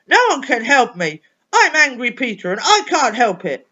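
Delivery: loud, furious shout of go away; angry shout. Acted. Angry boy shouting at the superpower to go away. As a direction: yell it to push them off.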